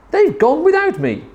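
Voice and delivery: high plaintive wail; crying out. A narrator's reading. Drip left behind by his family, plaintive high cry. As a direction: lament in a high voice. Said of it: "And he cried".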